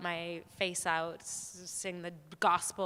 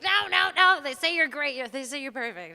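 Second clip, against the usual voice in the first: high-pitched insistent agent; 8 semitones higher, 9 dB louder than usual. Retold or imitated. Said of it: "she's like".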